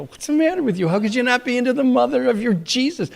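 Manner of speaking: high-pitched, incredulous retort; indignant challenge. Retold or imitated. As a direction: challenge them in a high, baffled voice. Indignant reply to Christians, retold.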